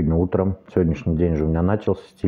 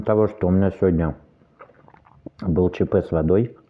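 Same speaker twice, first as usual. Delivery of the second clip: talking while chewing; eating fruit between words; mouth full of watermelon. Real, not acted. Seen 3: Eats watermelon from fork and talks with full cheeks early on.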